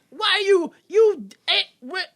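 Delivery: high, loud stammering outburst; imitating someone lost for words; flustered sputter. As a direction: sputter in broken, high-pitched bursts. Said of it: "And Larry's like".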